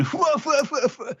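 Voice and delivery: very high puffing "fwa" laugh; copycat laugh. Retold or imitated. Host imitates the crew copying Killer, high mock laugh. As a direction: mimic a silly cartoon laugh, tailing off. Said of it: "and copying him".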